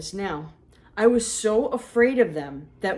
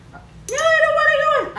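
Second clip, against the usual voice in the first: put-on much higher, louder kid whine; child protest; 8 dB louder than usual. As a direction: protest like a scared little girl. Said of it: "pretend this is me".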